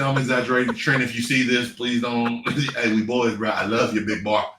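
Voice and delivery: ordinary talk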